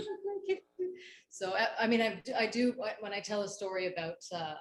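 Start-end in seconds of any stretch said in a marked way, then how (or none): 0.0-0.6 put-on high Indian-accented Peter Sellers voice, half crying. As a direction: keep the hammed-up Indian accent, tearful. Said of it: "You can do an accent like Peter Sellers in The Party?".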